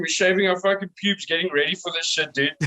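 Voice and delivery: ordinary delivery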